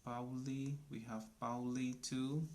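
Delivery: normal talking voice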